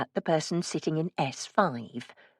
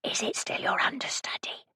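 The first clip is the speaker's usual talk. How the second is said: high soft whisper; 8 semitones higher, 7 dB quieter than usual. A narrator's reading. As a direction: keep it a hushed whisper. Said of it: "I whispered to him".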